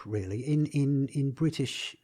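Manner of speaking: ordinary delivery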